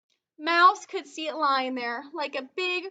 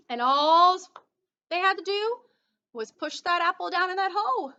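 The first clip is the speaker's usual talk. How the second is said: usual speaking voice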